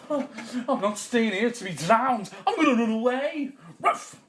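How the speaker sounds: high, loud indignant dog; talking dog. Acted. Dog refusing to be drowned, high and loud. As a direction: protest loudly as a hard-done-by dog.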